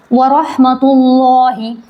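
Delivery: रहमतुल्लाहि slow, with a thick, full lam in ल्ला; full heavy lam. Shown as a demonstration. Teacher reciting slowly to show the heavy lam. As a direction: stretch रहमतुल्लाहि and darken the lam. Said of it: "ये यहाँ पर पूर होगा".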